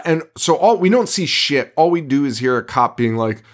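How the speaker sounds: ordinary talk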